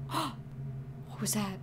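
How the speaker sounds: gasp, then slow hushed whisper; startled whisper. Real, not acted. Player hears something behind them, startled whisper. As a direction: whisper it after a frightened gasp.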